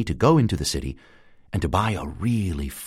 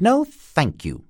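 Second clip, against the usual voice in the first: prim refusal; 11 semitones higher, 3 dB louder, slower than usual. A narrator's reading. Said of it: "said the magpie".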